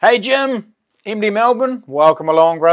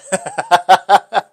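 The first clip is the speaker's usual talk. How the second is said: loud, higher burst of laughter; real amused laugh; 4 semitones higher, 6 dB louder than usual. Real, not acted. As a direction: laugh out loud in a quick run. Amused by competing super chats.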